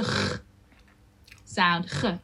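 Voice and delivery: ge with a rasping throat g; ge, guttural; Dutch G. Shown as a demonstration. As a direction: scrape the g at the back. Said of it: "the G".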